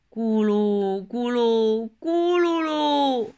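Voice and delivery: usual speaking voice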